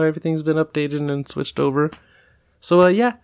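normal talking voice

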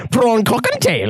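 put-on over-the-top German accent; mock German accent. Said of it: "You have to say it in a German accent".

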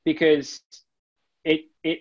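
ordinary talk, nothing marked

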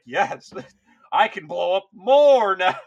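loud, slow, laughing gloat; gleeful laughing quote. Retold or imitated. Loud laughing gloat as the mutant. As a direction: cackle it out like a mad mutant.

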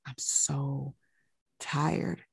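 soft, drained, near-sob; a hint of weeping, barely voiced; tearful, worn out. Patient crying on the bed to the doctor, softly tearful. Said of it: "She is just crying and crying".

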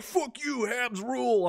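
high, mock-shouted jeer of a hockey fan; mocking angry fan. Mocking the hate mail from a fan. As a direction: ham up the dumb fan yelling back. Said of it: "And one guy be like".

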